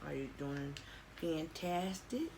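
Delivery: usual speaking voice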